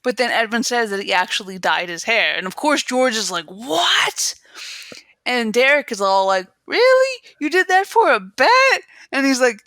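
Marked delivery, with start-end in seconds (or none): 3.4-4.3 mock shock. George's shock at dyed hair. 6.7-8.8 high, incredulous imitation; mock amazement. Derek reacting to the bet, mock amazed.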